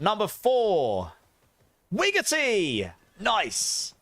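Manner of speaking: high, drawn-out winner call; excited winner announcer. Acted. Streamer reveals the fourth giveaway winner, hyped announcer. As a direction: stretch the number and name like a host.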